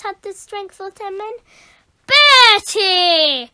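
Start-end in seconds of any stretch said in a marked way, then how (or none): 2.1-3.5 loud booming call of his name. Acted. Teacher catching daydreaming pupil, loud booming call. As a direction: boom his name across the class. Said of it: "boomed Missus Boot".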